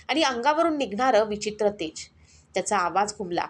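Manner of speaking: ordinary delivery, nothing marked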